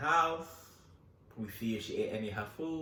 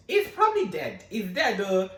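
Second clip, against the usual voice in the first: mock mom; 6 semitones higher, 7 dB louder than usual. Mom declaring the cat dead.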